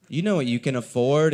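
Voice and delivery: usual speaking voice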